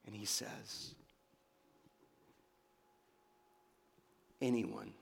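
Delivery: soft, low appeal with long pauses; hushed preaching. Real, not acted. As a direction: draw the congregation in very quietly. Hushed, intimate appeal to congregation.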